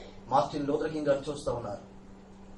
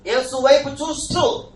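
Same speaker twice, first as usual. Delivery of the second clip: higher, louder and slow; loud slow call; 8 semitones higher, 7 dB louder, much slower than usual. Acted. Preacher pointing the church to Jesus, loud slow call. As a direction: swell up on చూస్తూ.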